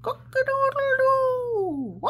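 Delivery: loud high rooster call; rooster crow. Shown as a demonstration. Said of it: "He can go like a rooster".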